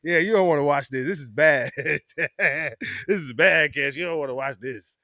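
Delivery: a friend pretending sympathy over the bad game; teasing friend. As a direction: rub it in gently.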